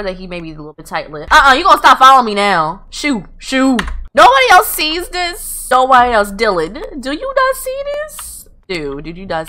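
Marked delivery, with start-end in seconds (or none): 1.3-3.8 high, firm dismissal; shooing away. Pretend. Player to Sim following her, shooing. 4.1-8.3 high, loud, insistent disbelief; incredulous. Real, not acted. Player to Dylan about what nobody sees, incredulous.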